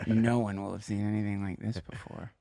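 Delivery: low, hushed stoner hubris, trailing to a whisper; mock stoner bravado. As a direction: play the cocky psychedelic college kid. Mocking his own cocky young self.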